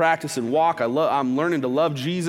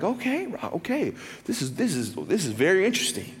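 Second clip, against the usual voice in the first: intrigued reaction; 2 semitones higher, 3 dB quieter than usual. Retold or imitated. Retelling his intrigued reaction.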